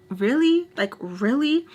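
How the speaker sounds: loud, high disbelief. Retold or imitated. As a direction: say it stunned, rising high. Disbelief at the hair pull.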